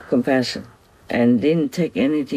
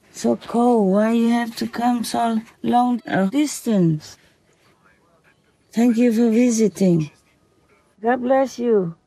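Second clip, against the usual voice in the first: tender talk to the fox; 9 semitones higher than usual. Real, not acted. Master speaking lovingly to the visiting fox, high and tender. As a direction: coo kindly to a visiting spirit.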